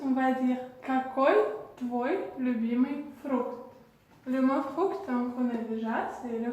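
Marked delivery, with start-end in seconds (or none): 0.8-3.7 "Какой твой любимый фрукт" word by word as ka-KOY tvoy lyu-BEE-miy frookt; Russian demonstration. Shown as a demonstration. Teacher giving learners the favourite-fruit question, Russian demonstration. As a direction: model "Какой твой любимый фрукт" carefully, pausing after какой. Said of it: "le dire en russe".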